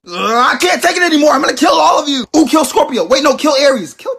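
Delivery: loud, high frantic raging; furious rant. Acted. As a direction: explode at the whole group. Stranded sign loses it.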